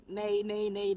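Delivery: ordinary talk, nothing marked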